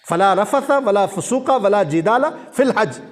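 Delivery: تلاوت کے انداز میں آہستہ; Quranic recitation. Acted. Quran verse recited in sermon.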